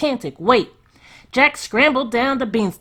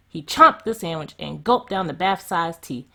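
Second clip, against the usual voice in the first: ordinary delivery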